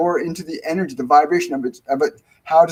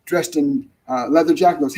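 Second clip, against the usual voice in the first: usual speaking voice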